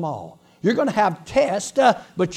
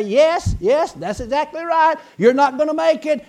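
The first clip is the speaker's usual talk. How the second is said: high, loud, taunting agreement; the devil; 7 semitones higher, 5 dB louder than usual. Acted. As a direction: confirm their failure in a raised devil voice. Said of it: "the devil would respond and say".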